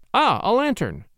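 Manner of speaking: Crosby cries out at the lantern; glad cry. A narrator's reading. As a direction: call out, pleased to see light. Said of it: "cried Crosby".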